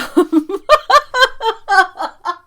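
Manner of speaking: a long high laugh that falls away. Real, not acted. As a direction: keep laughing until it trails off. Laughing at funny texts.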